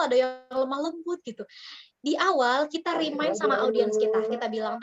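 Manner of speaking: usual speaking voice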